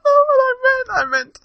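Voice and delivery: high-pitched, laughing through words. Real, not acted. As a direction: giggle it out. Cracking up at own mistake.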